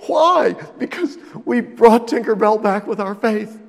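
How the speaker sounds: mock childish triumph. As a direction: gloat like a child. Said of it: "And gradually".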